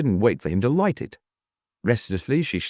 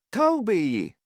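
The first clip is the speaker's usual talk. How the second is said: high, falling cry; 10 semitones higher than usual. A narrator's reading. Landlady calling Toby once more, dismayed cry. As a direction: call his name in high-pitched dismay. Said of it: "cried Missus Worth".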